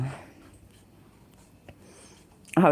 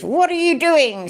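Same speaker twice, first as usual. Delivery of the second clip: cop voice; 8 semitones higher than usual. Retold or imitated. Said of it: "said the cop".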